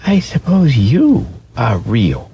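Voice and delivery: high, timid little rabbit; wide-eyed velveteen rabbit asking; small rabbit voice. Acted. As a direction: ask it like a shy toy rabbit. Rabbit voice, higher.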